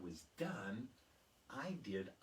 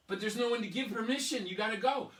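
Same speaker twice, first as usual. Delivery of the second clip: put-on much higher, louder kids begging; urgent pleading; 11 semitones higher, 10 dB louder than usual. Classmates urging Emily to fetch the teacher, high and loud. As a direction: plead with her like a panicked kid.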